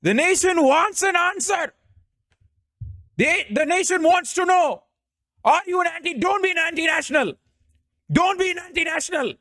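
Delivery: very high, shouting Indian news anchor; Arnab. Acted. Streamer showing off an impression for chat, shouting Arnab demand. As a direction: hector them in an outraged, high-pitched shout. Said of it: "I can do a decent Arnab impression".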